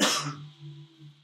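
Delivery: put-on a wounded old man's rasping cough; weak cough. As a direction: cough up blood, weakly. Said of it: "he begins to cough up".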